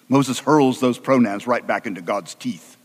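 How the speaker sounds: usual speaking voice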